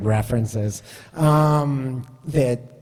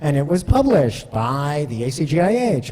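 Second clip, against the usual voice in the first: normal talking voice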